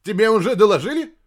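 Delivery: high, loud, quick blurt; urgent question. A narrator's reading. Scientist running into admiral, urgent.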